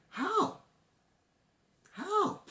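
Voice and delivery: high-pitched bewildered outburst; baffled. Real, not acted. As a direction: plead for an answer in a high, strained voice. Baffled by the plot.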